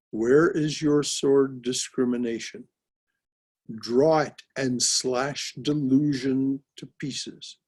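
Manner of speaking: slow, solemn declaiming of verse; scripture recitation. Acted. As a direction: proclaim it like holy scripture. Repeats verse slowly.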